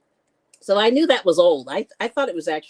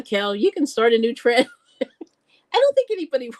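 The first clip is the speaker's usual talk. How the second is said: ordinary talk, nothing marked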